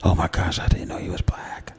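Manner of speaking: hushed, breathy gasp of surprise; mock shocked whisper. Interviewers whispering in surprise around the corner, mock shocked whisper. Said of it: "they say".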